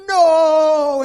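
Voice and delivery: high, loud scream of refusal; screaming child. Retold or imitated. A ten-year-old screams at the doctor, loud high shriek. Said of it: "And she screamed".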